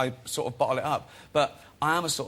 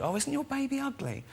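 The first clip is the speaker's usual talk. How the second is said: mock bright voice, much higher; blunt truth-teller; 12 semitones higher, 4 dB quieter than usual. Characters bluntly insulting a baby, high bright voice. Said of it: "They say things like".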